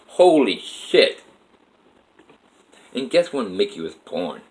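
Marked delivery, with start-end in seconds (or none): 0.1-1.2 slow, drawn-out disbelief; stunned. Retold or imitated. Recalling the look-alike, slow stunned disbelief. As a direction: let it sink in, awed. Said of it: "I'm like".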